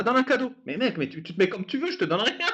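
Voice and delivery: ordinary talk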